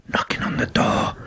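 whispered, voice off, breath only; drunk whisper. Retold or imitated. Drunk husband whispers to wife through the locked door. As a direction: hush it like a drunk sneaking in. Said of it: "Why are you whispering?".

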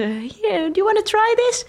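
lightly put-on English, rising high; a friendly stranger offering sweets; foreign offer voice. Scandinavians offering candy, English. (licht aangezette Engelse stem)

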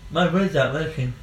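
teasing parroting in a put-on voice; mocking echo. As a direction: parrot his stumble back at him mockingly. Mocking friend's fumbled phrase.

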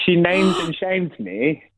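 drawn out, each word weighted; slow, pointed emphasis; slow dramatic reveal. Real, not acted. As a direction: draw it out slowly.

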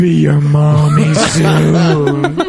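slow, drawn-out creepy mother voice; slow mock-creepy mommy line, laughing; creepy mommy whisper-tease. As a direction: croon it slowly like an unhinged surrogate mom.

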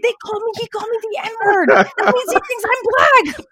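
high, thrilled telling; hammed-up glee; giddy excitement. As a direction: bubble over with delight. Retelling her excitement to mom.

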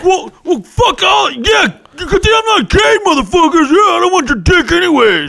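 high, loud, outraged yelling; indignant shout. Retold or imitated. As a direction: shriek it like a rejected guy. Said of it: "You're all screaming".

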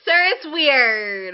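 slow, loud and pointed; telling off a creepy fan, slow and loud; slow scolding. Acted. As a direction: drag it out to shame him.

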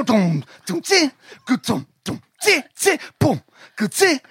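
mouthed drum pattern; beatbox the drum groove; imitating the band. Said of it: "your man was like going".

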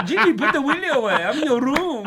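high, put-on French accent with laughter; mock French accent. Teasing the player about showers, in a French accent. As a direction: ham up the French teammate.

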